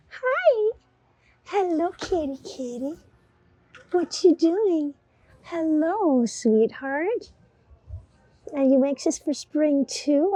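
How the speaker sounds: high sweet pet voice; high cooing to a cat. Real, not acted. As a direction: coo at the kitty. Talking to shop cat.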